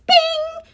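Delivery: high sung-out bing like an alert chime; high playful bing; notification ding. Pretend. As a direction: chirp it like a phone notification. Mimicking a phone notification.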